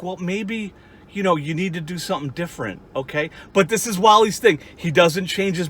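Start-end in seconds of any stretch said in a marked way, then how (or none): 0.0-3.3 a softer, lightly voiced bit of gentle advice. Friend finally tells crisis pal to change, softer gentle advice. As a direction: ease off into mild, patient counsel. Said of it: "you're gonna be like".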